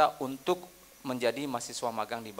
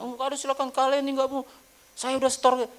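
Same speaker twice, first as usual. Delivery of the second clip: agitated customer; 12 semitones higher, 5 dB louder than usual. Retold or imitated. Angry customer retold.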